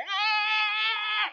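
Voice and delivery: put-on high shrieking child's yell; high angry child's cry; angry child shout. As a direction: mimic a furious little boy.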